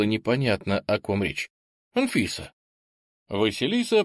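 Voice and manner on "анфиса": awkward. A narrator's reading. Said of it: "неловко добавил".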